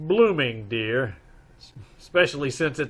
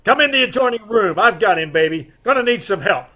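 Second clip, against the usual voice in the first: high, loud, strained calling; calling out mid-struggle; 7 semitones higher, 6 dB louder than usual. Acted. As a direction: shout for help while grappling. Wrestling gunman, calls wife.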